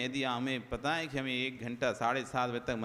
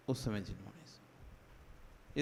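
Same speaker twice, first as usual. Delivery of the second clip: hushed; 7 semitones lower, 11 dB quieter, slower than usual. Real, not acted. Preacher advises listening calmly.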